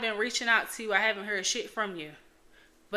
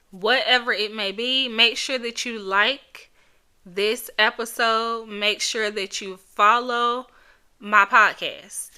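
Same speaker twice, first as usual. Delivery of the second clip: podcast plug; 5 dB louder than usual. Acted. Host breaking in to listeners, upbeat promo read.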